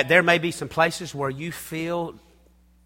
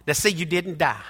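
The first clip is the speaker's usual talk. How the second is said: ordinary talk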